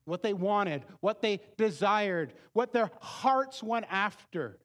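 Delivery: loud, high fervent preaching; preaching at full force. Acted. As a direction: drive the warning home to the congregation. Preaches to the congregation.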